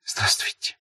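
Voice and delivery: shy whispered greeting. A narrator's reading. Girl answers from her hiding place in the rye, whispering. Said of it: "прошептала она".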